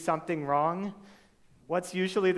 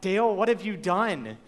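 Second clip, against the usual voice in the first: higher, louder accusing question aimed at Dale; 6 semitones higher, 8 dB louder than usual. Retold or imitated. Someone scolding Dale for what he did, accusing tone. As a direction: call Dale out like a scolding spouse. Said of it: "When somebody asks you that".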